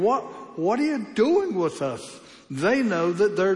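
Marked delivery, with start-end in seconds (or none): 0.0-2.2 higher, alarmed demon voice; mock demon cry. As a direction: play the demon panicking. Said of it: "So the demon is saying".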